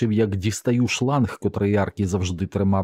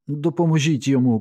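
high-pitched cry for help; screamed plea; 7 semitones higher, 4 dB louder than usual. A narrator's reading. Woman begging the men to help the dog, screamed plea. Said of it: "кричала вона".

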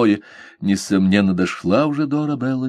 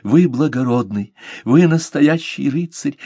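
fervent; 6 semitones higher than usual. A narrator's reading. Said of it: "горячо воскликнула она".

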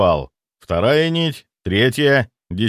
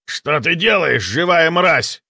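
angry; 6 semitones higher, slower than usual. Acted. Ghost snarls at the hero.

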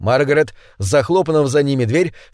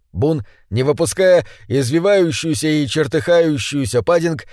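usual speaking voice

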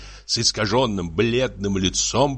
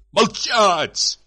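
high, sudden shout; sharp jump up in pitch, shouting; shouted order; 12 semitones higher, 4 dB louder than usual. Acted. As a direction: cry out the command as an enraged husband. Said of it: "крикнул супруг".

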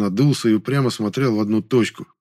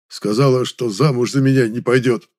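usual speaking voice

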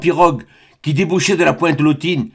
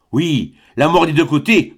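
usual speaking voice

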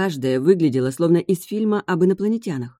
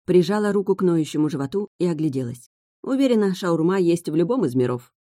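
usual speaking voice

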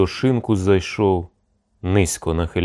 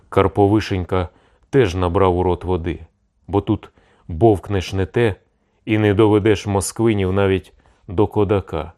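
usual speaking voice

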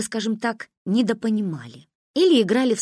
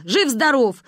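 higher, punched-out retort; annoyed; 5 semitones higher, 4 dB louder than usual. A narrator's reading. Father assuring mother that Misha is fine, annoyed. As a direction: throw it out crossly. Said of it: "рассердился папа".

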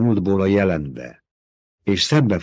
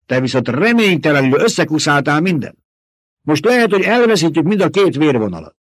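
higher, louder scolding; barking rebuke; 8 semitones higher, 6 dB louder than usual. Acted. Old woman snapping at Jessica over the duke's wish. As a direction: snap the accusation at her.

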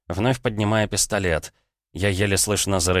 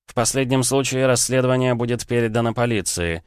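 higher, pointed mock-official explanation; sarcastic security officer; 5 semitones higher than usual. Officer tells the minister he is on his own, sarcastic. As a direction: spell out procedure tauntingly, pitched up.

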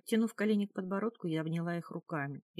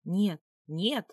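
louder, sharp protest; alarmed refusal; 2 semitones higher, 6 dB louder than usual. A narrator's reading. Captive fighting off the drunken jarl, alarmed cry. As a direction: cry out in protest, rising on the second word.

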